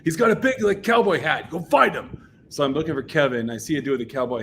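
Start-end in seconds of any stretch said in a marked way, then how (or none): 0.0-2.3 high, loud imitated command; mock yelled instructions; retold urgent order. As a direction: send someone off urgently, voice raised. Urgent order to find Kevin.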